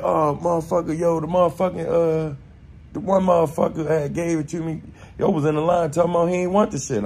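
lightly voiced Football making excuses; imitating Football. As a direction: brush it off like it's nothing.

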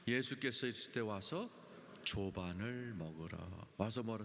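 usual speaking voice

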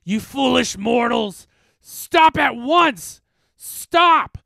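high, roaring monster voice; drawn-out, very loud demonic shout; enraged specter. A narrator's reading. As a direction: bellow at the mortals to halt. Specter screams at the chanters.

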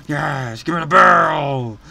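building to a high, loud shout; hyped. Real, not acted. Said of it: "I'm hyped!".

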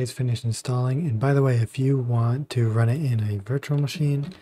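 ordinary talk, nothing marked

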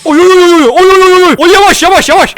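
high loud panicked yelping. Real, not acted. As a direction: yelp in panic as it comes at you. Panicked by approaching monster.

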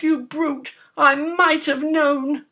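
very high, indignant pig squeal; pig crying out. A narrator's reading. Pig scolding the wolf over dynamite, high indignant cry. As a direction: protest in a high, loud piggy voice. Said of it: "Pig cried".

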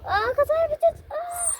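very high pretend shriek; mock panic. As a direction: squeal like a crash is coming. Pretend crash on the tractor.

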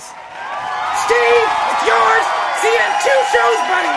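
loud, high, triumphant shout; shouted handover. Acted. Host shouting the handover to Steve across the studio. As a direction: yell it out to hand over the show. Handing the show over, calling out.

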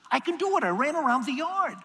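put-on high, pleading child voice; eager little girl. Daughter begging dad to race, high eager child. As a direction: chirp it like a determined little girl.

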